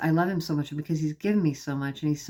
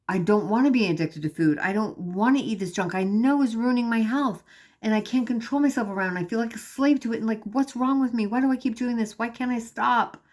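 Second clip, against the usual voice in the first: retold desperate prayer, higher and pressing on; higher-pitched retold outpouring of despair; desperate pleading; 5 semitones higher than usual. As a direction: plead with God in mounting frustration, no real sobs. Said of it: "So I got desperate".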